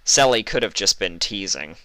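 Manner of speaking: plain reading voice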